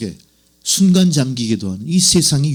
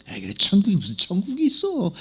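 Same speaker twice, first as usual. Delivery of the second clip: softer, higher sceptical scoff; dismissive scepticism; 4 semitones higher, 6 dB quieter than usual. Acted. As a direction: play a doubter brushing it off under the voice. Acting out sceptical churchgoers. (못 믿겠다는 듯 시큰둥하게)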